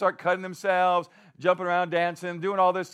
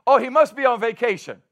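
loud, raised mock excuse; playfully sarcastic jab; 4 semitones higher, 9 dB louder than usual. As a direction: taunt with pretend reasonableness. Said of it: "he's mocking them".

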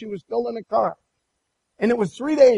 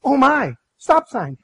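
sudden startled warning, raised voice; alarmed cry; 4 semitones higher, 5 dB louder, slower than usual. Retold or imitated. Wife warns husband at intersection, startled shout. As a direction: blurt it out as the car nears the stop. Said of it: "Chip's like".

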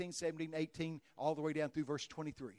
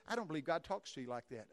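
usual speaking voice

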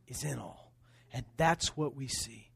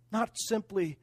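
ordinary talk

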